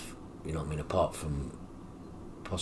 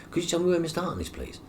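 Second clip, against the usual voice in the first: higher, mild polite asking voice; polite request; 10 semitones higher, faster than usual. Retold or imitated. Brother asking the nurse at the desk, high and polite. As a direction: imitate a careful, courteous enquiry.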